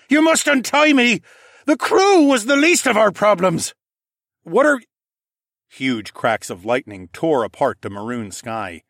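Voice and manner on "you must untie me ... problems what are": high, loud urgent demand; captain's shout. Acted. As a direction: shout orders as a desperate captain. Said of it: "Captain Nemus shouted".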